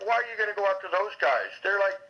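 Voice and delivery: imitating an indignant objection; retold protest. Retold argument, challenging FBI leader.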